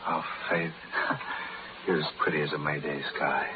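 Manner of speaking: soft, intimate murmur with a little breathy laugh; tender compliment. Acted. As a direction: woo her gently, close to her ear. Tender compliment to his love.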